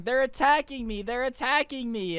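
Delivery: mock scream. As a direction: imitate a fake panicked scream.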